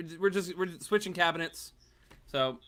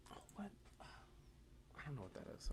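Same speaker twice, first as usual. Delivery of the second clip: whisper; 22 dB quieter than usual. Real, not acted. Muttered aside off mic.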